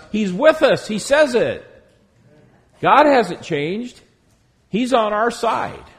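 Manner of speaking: preacher rising with fervour; impassioned preaching. Acted. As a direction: lift the voice to convince the room. Preacher rising to emphatic reassurance.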